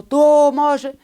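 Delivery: high, loud yelled name; parrot screaming. Acted. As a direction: scream it from inside the freezer. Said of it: "estaba al principio gritando".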